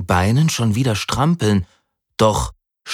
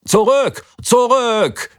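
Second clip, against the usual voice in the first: high, urgent order to turn back; commanding call; 4 dB louder, slower than usual. A narrator's reading. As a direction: bark the order at the class. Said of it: "befahl Herr Gunolli".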